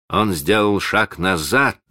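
ordinary delivery

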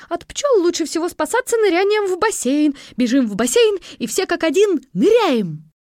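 high, loud, urgent commands; panicked teacher. A narrator's reading. Teacher to children fleeing the bees, loud panicked high. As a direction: sound the alarm and rally the kids.

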